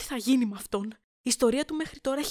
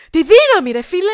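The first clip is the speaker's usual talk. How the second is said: louder, thrilled burst; excited fan; 4 semitones higher, 12 dB louder than usual. Acted. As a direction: gush about the film. Excited fan recounting scene. (ενθουσιασμένος θαυμαστής, δυνατά)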